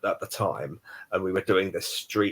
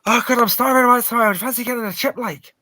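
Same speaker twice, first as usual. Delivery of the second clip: high, loud, fast Belfast voice; quick high Belfast impression; thick Belfast accent again; 14 semitones higher, 6 dB louder than usual. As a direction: rattle it off in broad Belfast. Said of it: "And he goes".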